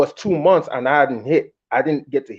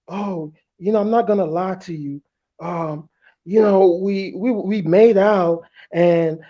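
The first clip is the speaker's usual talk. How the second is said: put-on confessing girlfriend; 6 semitones higher than usual. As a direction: drag out her hesitant confession.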